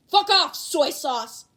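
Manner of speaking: slow, loud furious bark; angry yell. Acted. Bakugo yelling at Kaminari, a furious shout. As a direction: yell the insult at him.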